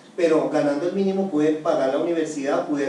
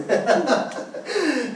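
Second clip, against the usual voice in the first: high-pitched laugh at himself; laughing at his own tearful past; amused laugh; 9 semitones higher than usual. Real, not acted. Young worker recalls crying all the time, laughing.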